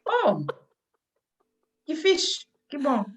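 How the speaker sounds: high-pitched acted pleasant surprise; imitated delight. Interpreter mirrors the delighted response.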